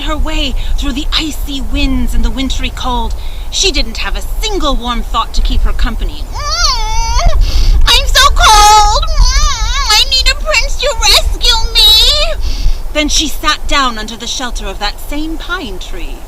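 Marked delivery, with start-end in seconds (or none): 6.2-12.3 much higher, loud complaining whine; whining stepsister. A narrator's reading. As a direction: moan theatrically about the cold.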